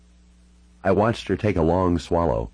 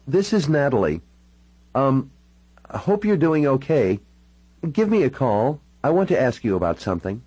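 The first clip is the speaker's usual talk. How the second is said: higher-pitched voice, halting over an answering machine; raised-pitch character voice with pauses between phrases; daughter leaving a phone message; 8 semitones higher than usual. Acted. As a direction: leave a hesitant message for mother, pitched up.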